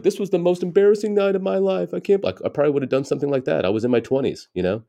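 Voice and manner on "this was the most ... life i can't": high-pitched mock distress; hammed-up embarrassed venting; mock upset complaint. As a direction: complain in a much higher, put-on voice. Imagined upset post by younger self.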